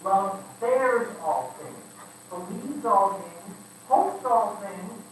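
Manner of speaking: slow, each verb stressed: bears, believes, hopes; slow recitation. Shown as a demonstration. Preacher reciting the verse to the church, slow and weighty. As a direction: lay out each line deliberately. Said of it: "reciting verse with weight".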